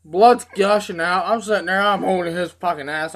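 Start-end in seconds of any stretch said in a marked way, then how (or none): none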